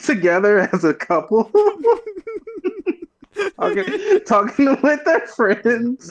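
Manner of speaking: high-pitched, helpless laughter through the words; real laughing. Real, not acted. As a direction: struggle to get the words out through giggles. Cracking up while telling the scene.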